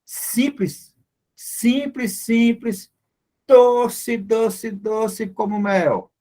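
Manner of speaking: high singsong, each word set apart; meme catchphrase. Retold or imitated. Teacher quoting a meme to the class, playful catchphrase voice. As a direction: quote a meme, drawing out the last words. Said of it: "como diz os memes por aí".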